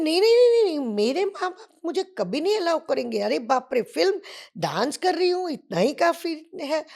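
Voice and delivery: high, loud, frantic no no no; panicked refusal. Retold or imitated. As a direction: protest wildly, as if scared of the idea. She refuses film offer in panic. (घबराहट में ऊँची, ज़ोरदार आवाज़ से इनकार)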